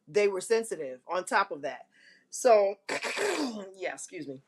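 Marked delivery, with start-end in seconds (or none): none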